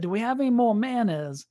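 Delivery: put-on light Cajun accent, asking again. Coworker Roy repeats his request in a Cajun accent. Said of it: "this Cajun guy named Roy".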